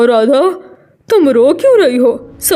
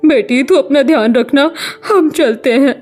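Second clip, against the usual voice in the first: sorrowful farewell; 9 semitones higher, 11 dB louder than usual. Acted. Parent leaving mistreated daughter behind, sorrowful. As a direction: say goodbye with a heavy heart. Insulted parent leaves, saddened.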